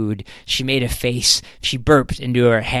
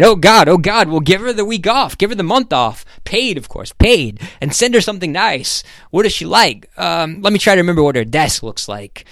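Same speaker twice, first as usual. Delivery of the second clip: higher, urgent instructions on the phone; concerned boss; 6 semitones higher, 4 dB louder than usual. Acted. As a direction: fire off orders with dismayed concern. Voices Ham reacting to phone news.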